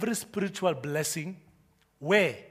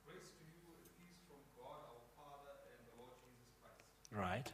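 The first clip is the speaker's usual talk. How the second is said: distant reading of scripture from across the room; faint voice reading the Bible; far-off reader; 7 semitones lower, 31 dB quieter than usual. Real, not acted. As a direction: read it as if from the back of the hall. Congregant reads scripture aloud.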